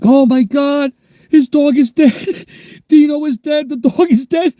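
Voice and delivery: high, hammed-up neighbor wail; mock panicked neighbors. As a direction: squeal like frantic neighbors. Neighbors freaking out, quoted.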